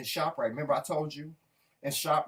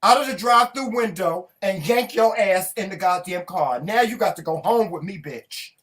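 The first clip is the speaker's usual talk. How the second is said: mock threat; 5 semitones higher, 7 dB louder than usual. Threatening drive-thru worker.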